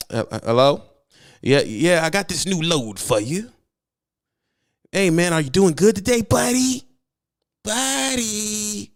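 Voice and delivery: butter up the driver like a pushy dispatcher; overly friendly dispatcher. Shown as a demonstration. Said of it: "this is how it sounds".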